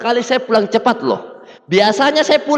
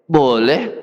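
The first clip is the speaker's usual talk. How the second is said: lowered voice of the upline; 9 semitones lower than usual. Retold or imitated. Upline's deep answer.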